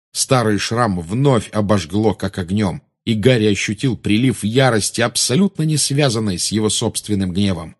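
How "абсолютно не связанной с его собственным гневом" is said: ordinary delivery, nothing marked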